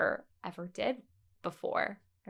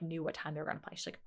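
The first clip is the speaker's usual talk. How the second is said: ordinary talk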